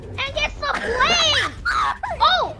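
high-pitched, excited battle commentary; live play-by-play. Acted. Player commentating boat versus creature battle, excited play-by-play. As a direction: call the fight like a commentator, building to shouts.

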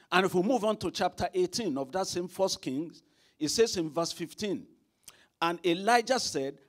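fiery pulpit preaching; preaching at full force. Acted. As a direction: preach it out, ringing loud.

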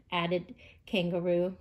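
usual speaking voice

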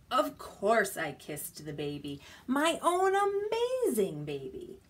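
singsong storybook voice for little ones; reading to a toddler. Acted. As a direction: read it playfully, question and answer. Reading picture book to toddlers.

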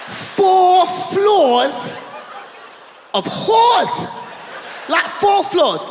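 milk the punchline word by word; each word lifted and stretched; punchline emphasis. Real, not acted. Punchline, drawn out.

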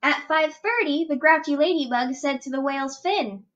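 plain reading voice

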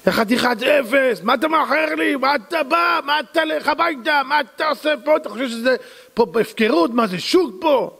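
high, furious boss tirade at a late worker; boss yelling at worker. Acted. Boss berates late worker with a high furious yell. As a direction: tear into him like a raging boss.

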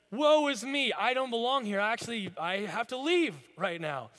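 high, loud cry of dismay; Isaiah pleading to leave, loud and high; overwhelmed cry. Acted. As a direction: cry out like a man undone before God. Isaiah overwhelmed before God.

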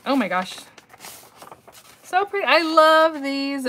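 high, loud, drawn-out delight; loud, slow, delighted coo; excited gush. Real, not acted. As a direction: swoon over the pretty cards. Gushing over the card designs.

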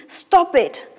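sharp, high, loud command to stop; angry king. Acted. King cutting off the boasting courtier, angrily. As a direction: cut him off like an angry king. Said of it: "The king is looking very angry".

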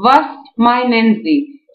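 Was meinen Sie read very slowly, word by word. Shown as a demonstration. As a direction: spell out the German phrase slowly for learners. (آهسته و شمرده خواندن)

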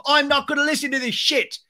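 high-pitched imitation of storming off; mock angry outburst. James walking off set, high mock outburst.

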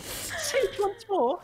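high, slow jeering; mocking taunt. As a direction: goad them to repeat it. Taunting rival.

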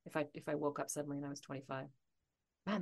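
ordinary talk

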